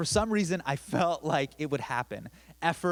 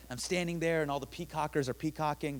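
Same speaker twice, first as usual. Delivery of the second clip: normal talking voice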